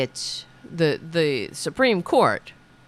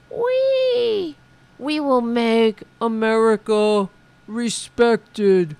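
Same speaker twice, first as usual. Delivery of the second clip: mocking rally voice; 5 semitones higher, 4 dB louder, slower than usual. Parodying the rally speaker he just played.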